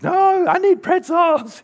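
put-on high, slow, loud little-boy voice; whiny toddler voice. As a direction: play the stubborn toddler demanding pretzels. Toddler son answers his dad.

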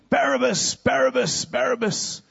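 high crowd chant; crowd shouting. Acted. Crowd screaming for the prisoner, high-pitched chant. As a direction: cry the name out like a mob. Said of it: "they're shouting and screaming".

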